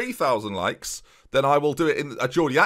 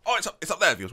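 normal talking voice

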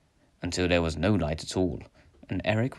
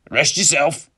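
loud, raised ghostly father; father's voice; 7 semitones higher, 8 dB louder than usual. A narrator's reading. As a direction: command him as a disembodied father. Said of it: "said his father's voice".

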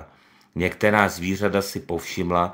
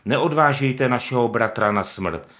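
higher, louder appeal; pleading shout; 5 semitones higher, 5 dB louder than usual. Acted. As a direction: beg them to stop. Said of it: "křičela".

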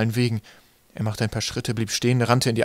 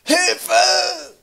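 very high, long shouted call; cry for help; 6 dB louder than usual. A narrator's reading. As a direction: someone in trouble screaming out of the dark. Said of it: "Die Stimme kam von links".

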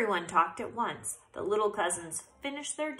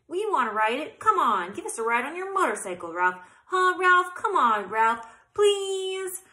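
pleading little cousins; 9 semitones higher, 9 dB louder than usual. A narrator's reading.

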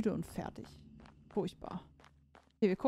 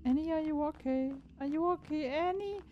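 high put-on voice; 6 semitones higher, 5 dB quieter than usual. Quoting song in put-on voice.

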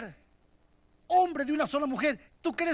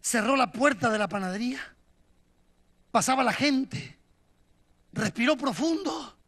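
usual speaking voice

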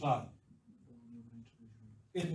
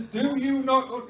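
put-on loud, high Yorkshire-accented rebuke; emphatic question in a Yorkshire accent; 6 semitones higher, 7 dB louder than usual. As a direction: slip into broad Yorkshire, exasperated. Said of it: "he doesn't say it in a Yorkshire accent".

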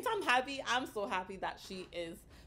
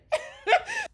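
high, loud giggle; embarrassed laugh; 9 dB louder than usual. Real, not acted. Laughing at own silly joke, high giggle. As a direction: burst out giggling at yourself.

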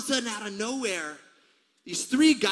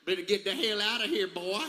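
exaggerated Kentucky country imitation; mock scolding in a thick Kentucky accent; 3 semitones higher than usual. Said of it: "say it with that country accent".

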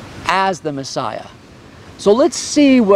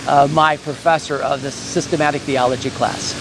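ordinary delivery

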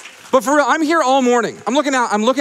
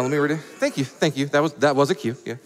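ordinary talk

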